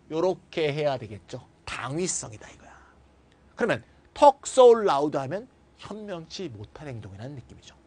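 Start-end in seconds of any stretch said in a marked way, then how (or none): none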